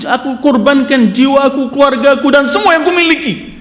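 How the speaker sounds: fervent story preaching. Acted. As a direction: swear it with rising urgency.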